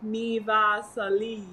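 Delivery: slow, stretched Mi Vasali; drawn out. Shown as a demonstration. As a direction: say Mi Vasali longer. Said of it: "Maybe you have to say it longer".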